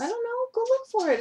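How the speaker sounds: high-pitched parental exasperation; exasperated parent. Real, not acted. Answering child hunting for purse.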